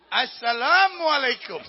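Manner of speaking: loud, high, drawn-out greeting; slow, booming acted reply; hearty called greeting. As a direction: sing out the greeting proudly across the distance.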